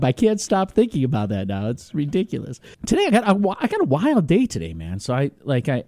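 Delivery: ordinary talk, nothing marked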